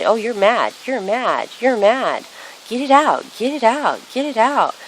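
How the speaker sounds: egging them on. Retold or imitated. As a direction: cheer them on to let it all out.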